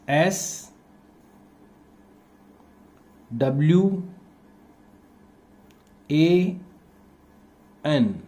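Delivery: one letter at a time, long pauses between; slow dictation. Shown as a demonstration. Teacher spelling swan while pupils write, slow dictation. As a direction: dictate each letter slowly for copying.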